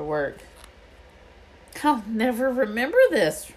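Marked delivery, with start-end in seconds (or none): 1.8-3.5 loud, higher exasperated outburst; bursting out. A narrator's reading. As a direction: blurt it out in frustration. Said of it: "Ron burst out".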